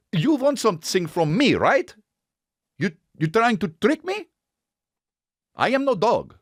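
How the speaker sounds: lightly put-on Russian accent, suspicious; a wary Russian dog grilling a stranger. Dog answering a friendly stranger, light Russian accent. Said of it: "in their culture means".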